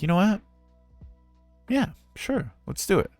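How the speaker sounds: high-pitched, decided retelling; retold game agreement. Speaker deciding to try roleplay, high game agreement. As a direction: agree gamely in a lifted voice. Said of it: "And I was like".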